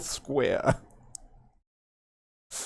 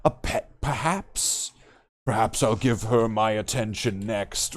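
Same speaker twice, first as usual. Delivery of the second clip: slow, quiet, menacing villain purr; 3 semitones lower, 6 dB quieter than usual. Retold or imitated. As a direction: tease the captive like a smug ape warlord. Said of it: "made Thade seem a little bit menacing".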